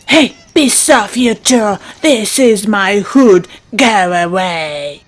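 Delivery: a hint of a deep-voiced bully squirrel; lightly voiced tough squirrel. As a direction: shoo them off like a gruff little boss. Tough squirrel chases others off.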